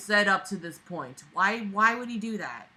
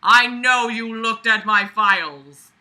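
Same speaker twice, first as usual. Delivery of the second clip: slow, louder accusing read; mock scream; 8 dB louder, slower than usual. A narrator's reading. Reader acting the son's accusation, louder and slow. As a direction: hammer each word at him. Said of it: "I screamed at him".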